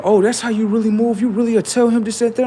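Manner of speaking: imitating her nagging; a hammed-up jealous girlfriend; mock accusing girl. Ex girl confronting him, quoted.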